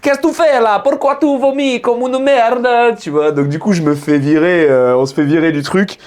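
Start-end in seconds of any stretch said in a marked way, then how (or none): 0.0-2.7 much higher, hammed-up Italian waiter; exaggerated Italian accent, scolding; mock Italian accent. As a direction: play the Italian waiter telling you off. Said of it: "c'est un espèce de rital".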